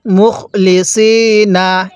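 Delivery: মুখ লি সি না with a long held সি; slow recitation. Shown as a demonstration. Teacher shows students how to read it, slow recitation. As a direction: recite মুখ লি সি না slowly, syllable by syllable.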